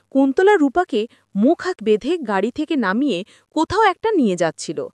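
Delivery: plain reading voice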